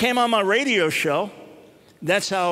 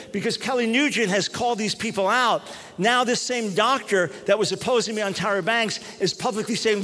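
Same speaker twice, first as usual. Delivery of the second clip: ordinary talk, nothing marked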